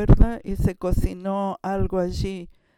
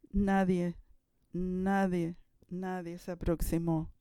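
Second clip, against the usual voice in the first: repeated nadie fading to almost nothing, much softer; softer and softer, nobody stepped up; dropping to a hush; 9 dB quieter than usual. Real, not acted. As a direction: let each nadie sink quieter for effect. Dramatic pause on nobody volunteering.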